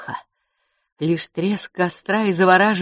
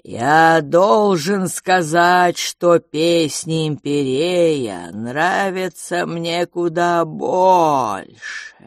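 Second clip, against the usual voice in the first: slow, louder, drawn-out royal creature; slow drawling creature voice; 3 dB louder, much slower than usual. Acted. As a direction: stretch the words like resin. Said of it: "Он говорил медленно и тихо, растягивая слова". (медленный тягучий голос короля)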